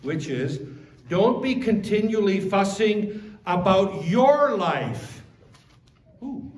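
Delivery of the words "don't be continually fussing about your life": YOUR drawn out and punched in a loud, high line; stress on YOUR. Shown as a demonstration. Preacher showing which word in the verse matters, stress on YOUR. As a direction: lean hard on the word "your". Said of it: "put the emphasis on the right syllable here".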